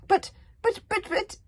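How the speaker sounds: high, loud flustered stammer. Acted. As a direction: choke on the words in shock. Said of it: "stammered Alvin the treacherous".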